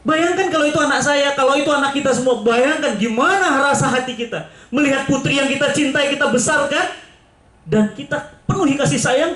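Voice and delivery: high, pleading preacher's plea; rising emotional sermon plea; impassioned appeal. Real, not acted. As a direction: make them feel the loss. Preacher appeals to parents' feelings.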